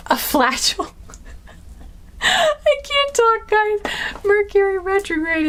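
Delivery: high, slowed giggly words after a flub; laughing at her slip. Real, not acted. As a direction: crack up at your own slip of the tongue. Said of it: "I keep making up words".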